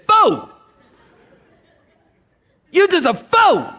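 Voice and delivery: high, loud scornful cry of "Fool!"; booming shout. Shown as a demonstration. Preacher voicing God's rebuke, high and loud. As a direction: hurl the word fool at him. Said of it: "He just said".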